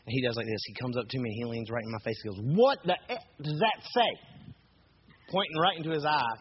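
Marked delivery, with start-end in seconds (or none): 2.6-4.1 high, confrontational mock scream; screamed demand. Angry stranger pointing at his own eye, screamed demand. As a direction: imitate a man screaming right in your face. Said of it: "cuss, screamed it right at me".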